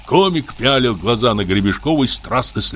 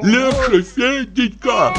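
high, fretful calling out; anxious Pochechuev; much slower than usual. A narrator's reading. Pochechuev calls from the next room, high and fretful. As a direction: plead from another room.

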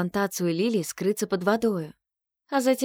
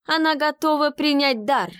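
triumphant exclamation; 8 semitones higher, 4 dB louder than usual. Acted.

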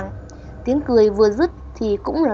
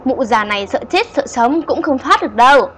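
lightly voiced ghost, louder and taunting; giọng ma éo éo chế nhạo; shrill ghost voice; 4 semitones higher, 7 dB louder than usual. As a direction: sneer at the old woman like a spirit. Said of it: "Cái bóng trắng lại éo éo".

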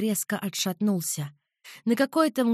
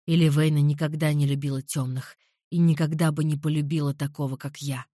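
lower, darker man's voice; low male voice; 6 semitones lower than usual. A narrator's reading. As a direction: voice him low and calm. Alvir's voice, held role.

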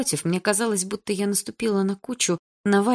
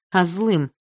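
ordinary delivery, nothing marked

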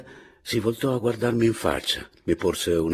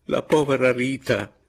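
higher-pitched, impassioned mention; heated; 5 semitones higher, 3 dB louder than usual. A narrator's reading. Signorina speaks of his late wife, with heat. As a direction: say it with rising fervour. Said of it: "riprese con foga".

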